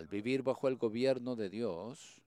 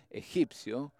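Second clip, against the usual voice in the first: laughing through "egipcio", higher and louder; 5 semitones higher, 5 dB louder, slower than usual. Real, not acted. The preacher laughs mid-quote at the Egyptian horse image. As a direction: break into a laugh on the word.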